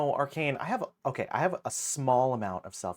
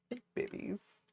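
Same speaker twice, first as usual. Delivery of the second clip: whisper; 11 semitones higher, 7 dB quieter than usual. Pretend. Whispered greeting.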